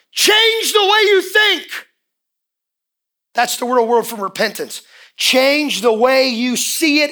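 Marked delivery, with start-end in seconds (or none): 0.1-1.9 slow, high, loud command; preached shout. Acted. Preacher commands the congregation, slow high shout. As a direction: hammer each word home slowly.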